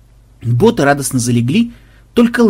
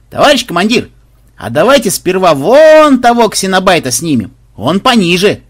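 high, loud, eager pitch; eager suggestion; 5 semitones higher, 6 dB louder than usual. A narrator's reading. Bot offering commander a fresh idea, loudly eager. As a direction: call the idea out to a superior.

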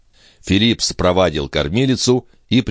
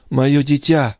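babbling; 9 semitones higher, slower than usual. Acted. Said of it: "лепетала Андреа".

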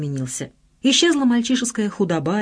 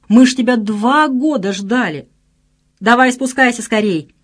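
loud, higher impatient call; urging shout; 5 semitones higher, 5 dB louder than usual. A narrator's reading. Friend who waited two years, shouting. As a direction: holler for him to hurry down.

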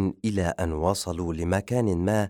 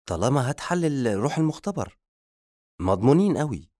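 higher-pitched smart-aleck reply; clever retort; 6 semitones higher, faster than usual. A narrator's reading. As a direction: assistant showing off his wit. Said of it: "رد مساعده بذكاء". (رد متذاكي بنبرة عالية)

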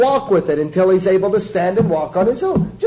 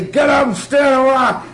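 shouted, raised-pitch command; mock stern order; 7 semitones higher, 3 dB louder than usual. Preacher mimicking someone ordering a crawling baby to walk, mock shouting. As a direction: bark it like an impatient drill order.

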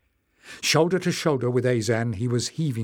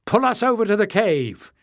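high loud call; 9 semitones higher, 5 dB louder than usual. A narrator's reading. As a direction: shout the order across. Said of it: "Leif called".

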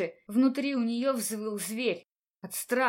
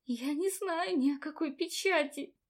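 trembling voice; 7 semitones higher than usual. A narrator's reading. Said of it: "настолько сильно дрожал голос".

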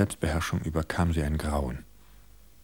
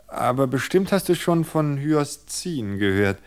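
wife's confession; 6 semitones higher, 4 dB louder than usual. Acted. Wife describing the poison.

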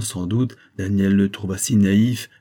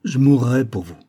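a higher, louder lover's exclamation; enthusiastic cry; 4 semitones higher, 5 dB louder than usual. A narrator's reading. Paul swears he would die for her, enthusiastic exclamation. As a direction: declare it with keen devotion. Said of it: "s'écria Paul avec enthousiasme".

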